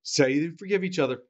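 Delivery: ordinary talk, nothing marked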